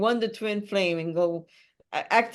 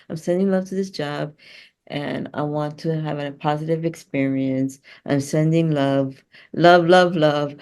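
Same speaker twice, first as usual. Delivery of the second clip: a loud, slowing love mantra, swelling as it repeats; loving mantra; 2 semitones lower, 5 dB louder than usual. Shown as a demonstration. Coach teaching clients to send love to a dreaded job, chanting louder. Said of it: "you say".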